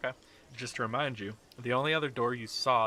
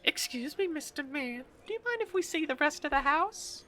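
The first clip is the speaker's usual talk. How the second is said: very high, sugary lady voice; sweet lady. Acted. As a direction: sweet-talk the guard. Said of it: "walks you up to the door and says".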